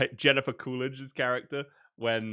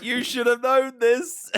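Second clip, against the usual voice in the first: high, slow scolding voice; mock scolding; 11 semitones higher, 5 dB louder, slower than usual. Friend mock-scolding about a known twist, high and slow. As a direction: drag it out like a stern teacher. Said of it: "He's like".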